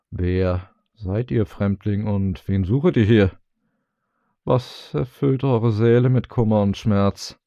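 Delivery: put-on slow, wavering old man asking a stranger; a stooped elder, slow and searching; old man's question. A narrator's reading. Old villager questions the grieving stranger, slow and aged. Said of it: "ein gebeugter Greis".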